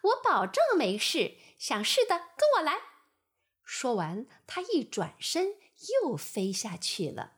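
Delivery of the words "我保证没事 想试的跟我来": high, loud fly voice, hurried; exaggerated fly. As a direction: lead the others on boldly. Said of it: "他夸张地戳戳脚". (夸张的苍蝇)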